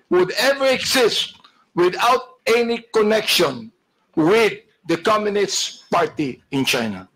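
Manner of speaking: slow, deliberate declaration; emphatic insistence. Real, not acted. Lawmaker pressing a company witness on China ties, slow and emphatic. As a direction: spell it out slowly, phrase by weighty phrase.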